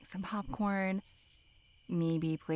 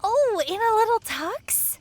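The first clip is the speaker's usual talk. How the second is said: excited squeal; 8 dB louder than usual. Acted. Gushing over dressed-up hot dog.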